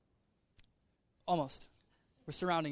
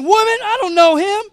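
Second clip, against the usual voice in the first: mock dismissive Peter; 10 semitones higher, 14 dB louder than usual. Peter brushing off the girl.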